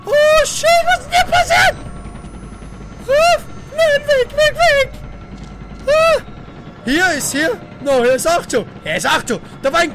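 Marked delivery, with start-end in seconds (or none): 0.0-6.2 high shrill panic yells; panicked screaming. Real, not acted. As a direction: yell in terror. Ghost attack panic.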